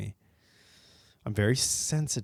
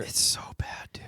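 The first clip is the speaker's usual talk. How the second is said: whispering; 4 dB quieter than usual. Real, not acted. Muttered agreement.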